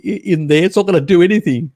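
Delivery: ordinary talk, nothing marked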